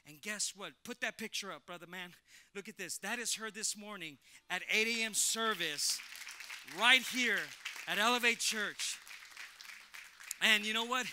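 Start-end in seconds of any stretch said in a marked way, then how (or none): 3.0-11.1 excited reveal. Real, not acted. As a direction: show the miracle to the church. Revealing healed mother's photo.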